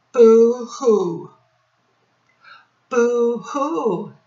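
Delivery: high, hammed-up boo-hoo; mock crying. Teacher pretending to cry about a frown. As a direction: fake a sob.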